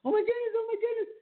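very high-pitched mock panic; retold panic. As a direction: squeal it as a re-enacted panic. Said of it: "I started panicking".

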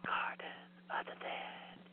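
eerie breathy whisper. Pretend. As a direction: whisper the title spookily. Whispered repeat of title.

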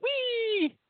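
very high squeal of wee; a sudden high squeal like a child on a slide; squeaky wee. Retold or imitated. Cutting off a friend's joke with a high squealed wee. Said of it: "I went".

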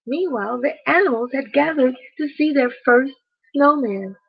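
plain reading voice

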